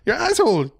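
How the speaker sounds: high mock French accent explaining. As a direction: lay on a thick French accent. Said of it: "talking in a French accent".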